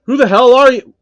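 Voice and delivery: loud, high demanding yell; furious challenge; angry. Acted. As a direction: bark the question. Phil confronts stranger.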